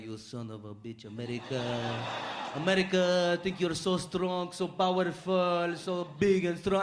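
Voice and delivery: put-on slow, grumbling Arabic-accented English; moaning Jordanian cousin. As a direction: curse slowly in a heavy Arabic accent. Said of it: "all bitching and moaning about America".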